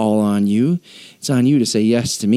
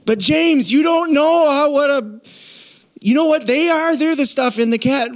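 much higher, whining protest; whiny churchgoer; 12 semitones higher than usual. Acted. Congregant pleading excuses to James in a whine. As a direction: complain like a defensive kid. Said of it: "every one of us wanna be like".

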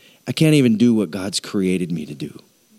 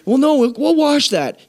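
much higher, stubborn mock voice; mimicking hoarder; 14 semitones higher than usual. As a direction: imitate the clinging owner, pitched up. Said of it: "and they're like".

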